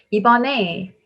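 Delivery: ordinary delivery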